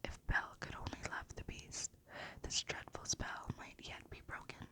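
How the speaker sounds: usual speaking voice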